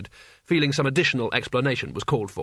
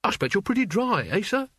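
forced friendliness; 7 semitones higher than usual. A narrator's reading. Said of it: "ghastly camaraderie".

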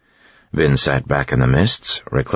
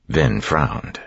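usual speaking voice

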